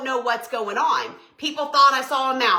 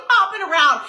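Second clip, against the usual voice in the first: normal talking voice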